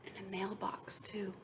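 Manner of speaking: soft whisper; whispering aside. Real, not acted. Hushed aside to students.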